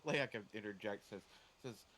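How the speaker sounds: ordinary talk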